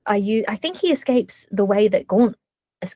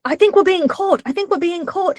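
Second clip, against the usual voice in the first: high, frantic worried voice; panicky co-pilot; 10 semitones higher than usual. Retold or imitated. Shell-shocked co-pilot fretting to the pilot, high and panicky. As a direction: squeak it out like a nervous co-pilot. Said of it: "keeps being like".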